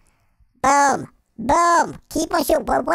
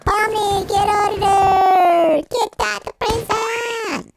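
slow, high shouted call; 7 semitones higher, slower than usual. Real, not acted. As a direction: yell at her to get out. Calling out to the character.

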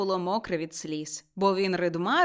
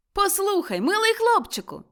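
high, friendly hail; raised, eager summons; calling out; 11 semitones higher, 4 dB louder than usual. A narrator's reading. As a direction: call down to the lad. Scrooge calls down to the boy.

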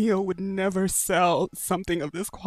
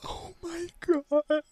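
higher, slow stunned oh my God; shocked; 9 semitones higher, slower than usual. Real, not acted. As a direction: let it out in shock. Reacting to price.

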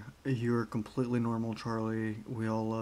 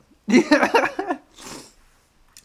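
loud high laugh; 14 semitones higher, 11 dB louder than usual. Real, not acted. Reacting to snorting glue, a loud high laugh. Reacting to absurd clip line.

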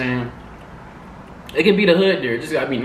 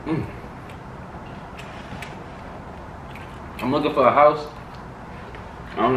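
speaking between bites; talking while chewing; 4 dB quieter than usual. Real, not acted. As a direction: mouth partly full. Seen 3: man bit food just before, cheeks seem working while talking early.

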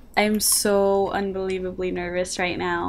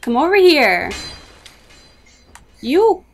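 shouting; 6 semitones higher, 10 dB louder than usual. Real, not acted. Yelling at enemy in game.

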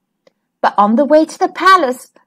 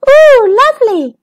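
high, louder ooh of delight; 10 semitones higher, 6 dB louder than usual. Acted. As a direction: coo over the ladybird, pitched up. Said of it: "said Lulu".

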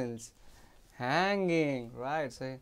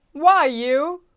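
high loud call; 9 semitones higher, 14 dB louder than usual. Acted. Maid calling out to Charnu, high and loud. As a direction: shout for the boy's attention.

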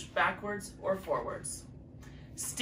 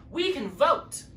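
eager; 7 semitones higher, 4 dB louder, slower than usual. Acted. Eager proposal to the animals.

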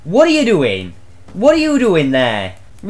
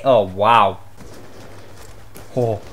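ordinary talk, nothing marked

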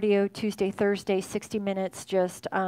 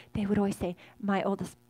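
ordinary talk

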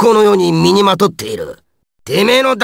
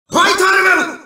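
high-pitched shouted attack name; attack call; 6 semitones higher, faster than usual. Acted. Fighter unleashing his power, high battle cry.

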